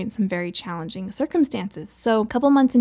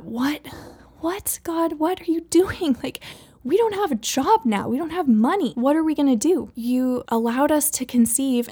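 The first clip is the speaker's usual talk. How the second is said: high, pleading cry of panic to God; desperate prayer; 3 semitones higher than usual. Retold or imitated. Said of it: "And I thought".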